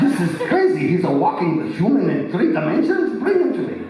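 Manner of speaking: high, excited put-on lady professor voice; hammed-up high professor impression; Dr. Ruth-like professor. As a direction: exclaim like an astonished little lady doctor. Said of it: "She doesn't talk like that".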